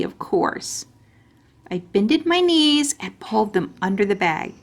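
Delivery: plain reading voice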